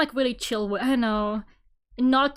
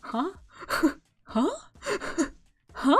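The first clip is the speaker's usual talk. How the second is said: small, rising puzzled huhs; mock confusion, voice curling upward; baffled huh; 3 semitones higher, 4 dB quieter than usual. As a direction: repeat a stunned questioning huh. Acts out baffled reaction to question.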